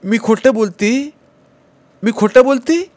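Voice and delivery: sobbing protest. Acted. Madwoman sobs to mother that she does not lie. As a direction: plead through sobs, rising on खोटं. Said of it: "असं म्हणून ती हुंदकत बोलली".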